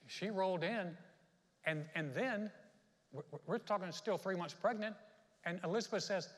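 ordinary talk

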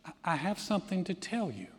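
hushed, hesitant, ominous announcement; retold with quiet foreboding. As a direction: softly imitate a mother about to break grave news. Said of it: "It sounded ominous".

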